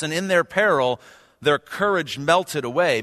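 scripture reading. Acted. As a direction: read the psalm with building drama. Reads psalm aloud in sermon.

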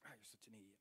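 whispered, rapid self-scolding; beating himself up. Retold or imitated. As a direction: mutter insults at yourself under your breath. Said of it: "I'm just beating myself up".

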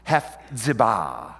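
Hephzibah drawn out slowly, hef-zi-BAH; end-stressed pronunciation. Shown as a demonstration. Preacher teaching the Hebrew accent, Hephzibah slowly stressed at the back end. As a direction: land Hephzibah on a long final BAH. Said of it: "Hebrew loves the back end for the accent".

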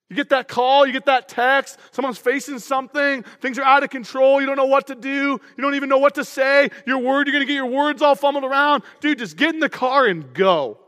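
usual speaking voice

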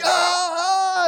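a sudden high excited cry. Retold or imitated. Couple waving down the prophet, high excited cry. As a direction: yelp up high to catch his attention.

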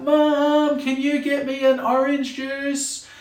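put-on high, slow whiny child calling out; a lazy child yelling out to mum in the house; yelling kid. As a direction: call to mum from another room. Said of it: "the kid's yelling out".

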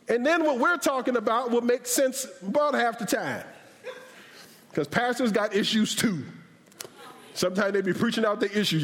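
ordinary talk

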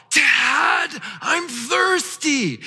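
put-on high, slow, drawn-out kid whine; whiny child. Pastor imitates his thirsty child whining at him, high and slow. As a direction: mimic a child whining like a parched castaway. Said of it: "they whine in a way".

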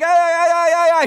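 high, loud string of mock yelps; panicked yelping. Crew as poo flies the other way, panicked yelps. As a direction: imitate a crew shrieking in alarm. Imitated screaming at flying poo.

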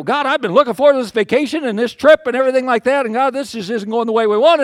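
high, loud griping; bad-attitude complaint. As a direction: whine upward as if protesting to God. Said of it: "I was just getting a terrible attitude".

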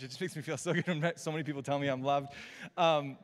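ordinary talk, nothing marked

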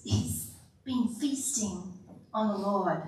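ordinary delivery

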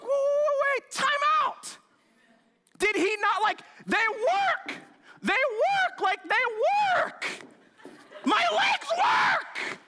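a healed man who cannot believe his legs work; freaking out. Retold or imitated. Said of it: "I'm like".

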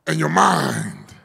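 usual speaking voice

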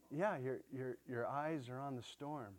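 ordinary talk

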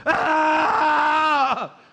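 loud, high, held scream; panicked inner scream. Acted. Preacher voices what you feel on the inside, panicked scream. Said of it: "On the inside, you're going".

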